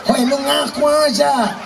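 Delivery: Javanese cop barking in Kupang dialect. Retold or imitated. Javanese policeman interrogating in Kupang dialect, rough Kupang accent. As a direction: demand a confession Kupang style. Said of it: "Yang Jawa logat Kupang".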